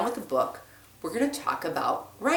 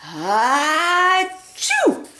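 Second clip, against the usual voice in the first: a high, loud achoo; big sneeze; 10 semitones higher, 6 dB louder than usual. Acted. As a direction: sneeze it out full force. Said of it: "before he could cover his snoot".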